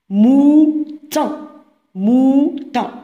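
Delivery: "Mouton" as two separate syllables, the first long; sounding out. Shown as a demonstration. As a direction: hold the first syllable of "Mouton", then place the second. Teacher sounds out word for pupils. ("Mouton" مقطع بمقطع، مع مد الأول)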